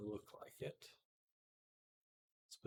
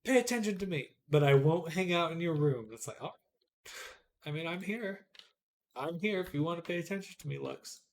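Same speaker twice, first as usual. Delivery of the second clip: voicing the cat; 5 semitones higher, 10 dB louder than usual. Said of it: "She's like".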